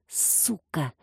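soft, venomous hiss; hissed through teeth. Acted. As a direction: spit it out under breath. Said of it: "прошипел он сквозь зубы".

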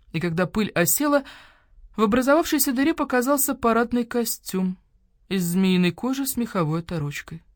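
ordinary delivery

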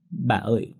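put-on broken, halting words of a crying child; sobbing, acted. A narrator's reading. Cậu bé ôm bà khóc nức nở, acted sobbing. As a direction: act choked with tears, unable to finish. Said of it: "Tôi khóc nấc không nói lên lời".

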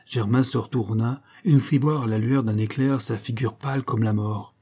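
plain reading voice

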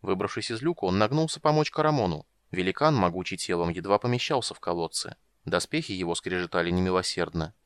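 ordinary delivery, nothing marked

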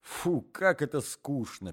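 a bored wife brushing him off. Acted. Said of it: "перебила дама".

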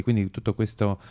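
ordinary delivery

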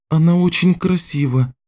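put-on high girlish character voice; high-pitched girl making an observation; young girl's voice. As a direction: voice the young healer, pitched well up. Girl remarks over her shoulder.

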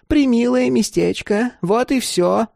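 high, airy crone voice; old woman feigning innocence. Acted. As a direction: make small talk, pitched well up. Old woman covers her interest.

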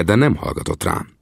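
plain reading voice

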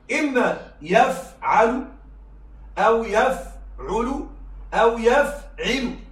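يفعل slowly split as yaf-alu, yaf-ulu, yaf-ilu; change the middle vowel of each يفعل: a, u, i; contrasting verb patterns. Shown as a demonstration. Teacher listing present-tense patterns, يفعل in slow separated syllables. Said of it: "والمضارع من الثلاثي".

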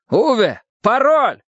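high, insistent call; urgent shout. Acted. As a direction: shout to rouse a dazed man. Shouting at a dazed man for password.

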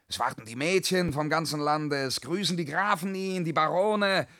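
rising, swelling grandeur; carried away by the fantasy; rapturous daydream. Acted.

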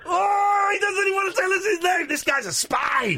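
high, hammed-up accusing cry; mock outrage. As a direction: cry out in pretend scandal. Host mock-accuses shy caller of spying.

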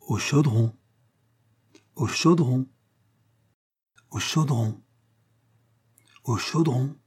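slow, each "au Chaudron" set apart by long pauses; patient, deliberate repetition, word by word; slow and distinct. Acted. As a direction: spell out "au Chaudron" carefully for the dog. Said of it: "en parlant lentement et très distinctement".

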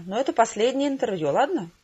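usual speaking voice

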